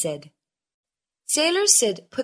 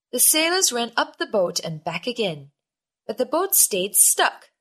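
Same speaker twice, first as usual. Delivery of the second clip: usual speaking voice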